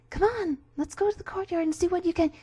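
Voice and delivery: high, bright character voice; Meta Knight voice. Acted. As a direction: lead him on eagerly. Meta Knight inviting Kirby.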